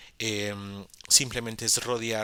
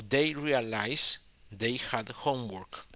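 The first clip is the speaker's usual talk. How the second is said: leído despacio; 4 dB louder, slower than usual. Shown as a demonstration. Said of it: "Pero aquí dice".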